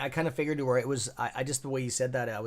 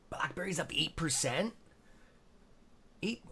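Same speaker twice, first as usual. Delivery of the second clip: rising, higher-pitched disbelief; surprised question; 4 semitones higher than usual. Real, not acted. Streamer reading a stock jump to viewers, surprised. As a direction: ask it as if you can't believe the figure.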